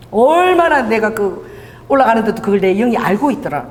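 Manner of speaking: usual speaking voice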